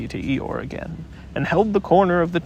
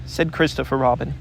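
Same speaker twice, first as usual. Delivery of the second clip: usual speaking voice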